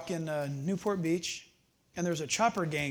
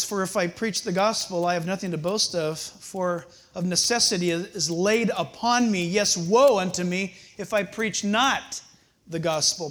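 scripture reading; 4 dB louder than usual. Acted.

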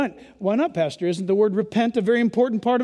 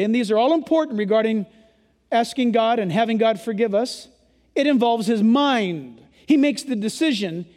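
ordinary talk, nothing marked

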